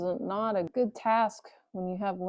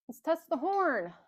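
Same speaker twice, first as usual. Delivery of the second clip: higher, eager announcement; decided, eager; 8 semitones higher than usual. Real, not acted. Mechanic picks horn test for viewers, eager. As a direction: announce the plan with glee. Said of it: "I think we have a winner".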